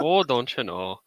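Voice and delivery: put-on folksy Minnesota accent, playful. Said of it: "I'm stuck back in that Minnesota accent".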